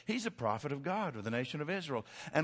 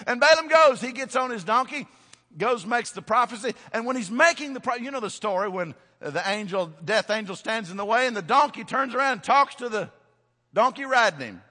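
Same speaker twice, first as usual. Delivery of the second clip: loud, high-pitched storytelling at full force; fired-up preaching; 10 semitones higher, 13 dB louder than usual. Real, not acted. Preacher racing through Balaam's donkey story, fired-up and loud. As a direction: preach the tale in a raised, excited voice.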